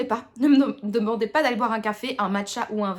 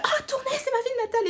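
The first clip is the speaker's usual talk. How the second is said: much higher, fast and eager Tournai pride; excited boast; faster than usual. Retold or imitated. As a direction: gush proudly about the hometown, quick and high. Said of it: "je suis là". (fierté enthousiaste, aiguë et rapide)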